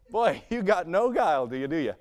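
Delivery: chuckle, then a teasing jab; laughing tease. Retold or imitated. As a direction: rib him good-naturedly while laughing. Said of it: "he just goes".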